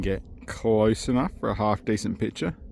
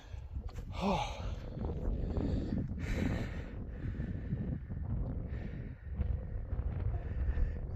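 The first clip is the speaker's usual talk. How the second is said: heavy breath out; 8 semitones higher, 12 dB quieter than usual. Real, not acted. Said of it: "Excuse the breathing".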